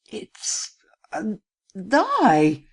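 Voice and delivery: halting, whispery high protest; horrified, dragged-out denial; terrified stammer. A narrator's reading. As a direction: stammer it slowly in terror. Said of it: "stammered the terrified lad".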